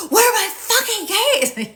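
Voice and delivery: high, loud exasperated yell; hammed-up screaming meltdown; frantic outburst. Same line, escalated by grief.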